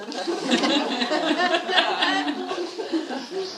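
long genuine burst of laughter; helpless amused laughing fit; real laugh. Real, not acted. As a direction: crack up at yourself. Laughs at her own story.

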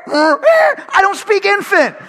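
high, loud, slow exasperated whine; frustrated dad's mock whine; exasperated cry. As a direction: wail it like a helpless dad. Frustrated dad in story.

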